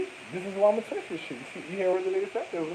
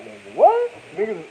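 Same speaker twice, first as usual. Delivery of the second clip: normal talking voice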